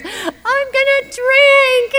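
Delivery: high, very slow, sobbing wail of "I'm gonna drink"; crying wail. Retold or imitated. Crying to the AA members outside Starbucks, high slow wail. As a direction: let it break into a pitiful howl. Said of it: "I burst into tears".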